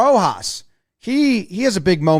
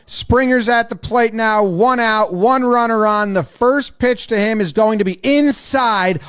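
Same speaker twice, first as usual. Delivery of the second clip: normal talking voice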